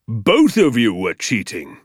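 slow, emphatic condemnation; deliberate, menacing teacher; stern accusation. Acted. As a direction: pronounce it like a verdict. Teacher accuses both pupils.

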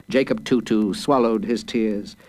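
usual speaking voice

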